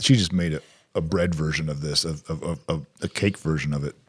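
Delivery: talking while chewing; words around a mouthful; chewing noisily. Real, not acted. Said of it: "I'm sorry I'm chewing on a microphone".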